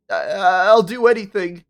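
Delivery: loud stammering beg; desperate plea. Acted. Ben pleads.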